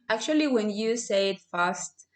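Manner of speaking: usual speaking voice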